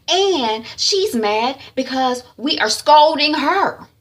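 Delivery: high, loud scolding with drawn-out no; indignant rant. Retold or imitated. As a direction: rant as an exasperated mother. Mother rants about daughter.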